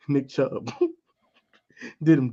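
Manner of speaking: ordinary talk, nothing marked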